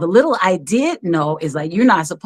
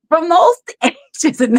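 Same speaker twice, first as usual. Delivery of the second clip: laughing; 10 semitones higher, 4 dB louder than usual. Real, not acted. Laughing while telling childhood.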